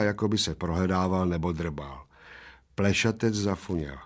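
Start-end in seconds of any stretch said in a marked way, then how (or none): none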